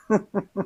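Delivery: soft, short mocking laugh; scoffing chuckle. Worker scoffing at new leader's loyalty claim, soft chuckle. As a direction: chuckle under the breath in disbelief.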